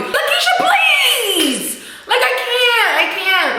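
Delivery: high, laughing outcry at the screen; fed-up shout, half laughing; laughing exasperation. Real, not acted. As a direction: yell at her through laughter, can't believe it. Exasperated at character Lakeisha.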